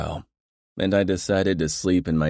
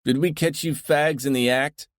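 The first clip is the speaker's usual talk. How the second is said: hollering; 10 semitones higher than usual. A narrator's reading.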